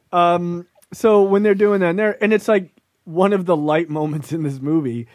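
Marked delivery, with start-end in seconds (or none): none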